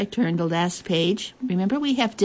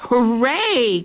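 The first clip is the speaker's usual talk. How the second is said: higher, drawn-out storybook hooray; joyful cry; 6 semitones higher, 3 dB louder than usual. A narrator's reading. Queen greets her old friend's return, joyful cry. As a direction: cheer it out with a long, lifted second syllable. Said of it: "cried the Queen".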